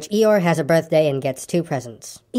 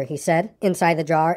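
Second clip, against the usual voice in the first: ordinary delivery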